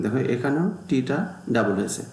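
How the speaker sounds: ordinary talk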